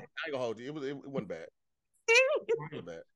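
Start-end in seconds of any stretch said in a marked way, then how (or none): 2.1-3.0 very high squeaked line; comic falsetto confession; squeaky mock admission. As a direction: squeak it out sheepishly.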